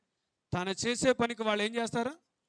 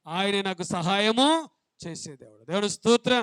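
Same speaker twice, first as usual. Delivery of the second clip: loud, raised testimony shouted to the church; fervent preaching; 2 semitones higher, 7 dB louder than usual. Acted. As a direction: build the declaration with full force.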